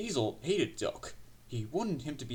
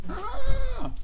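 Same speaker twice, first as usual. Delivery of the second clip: very high, loud "ah" shriek; high scream; 11 dB louder than usual. A narrator's reading. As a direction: let out a squealing scream. Trucks screaming as they are pushed.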